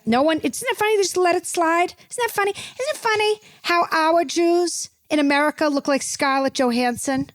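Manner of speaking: high, faux-innocent "isn't it funny"; sarcastic wonder. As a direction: play dumb with a sting.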